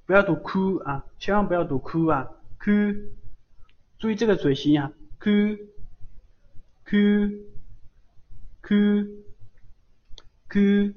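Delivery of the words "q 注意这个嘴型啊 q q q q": Q said higher, watch the mouth shape; 注意嘴型读Q; model ku. Shown as a demonstration. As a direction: drill Q with unrounded lips.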